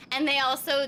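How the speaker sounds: ordinary delivery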